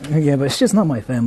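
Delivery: ordinary talk, nothing marked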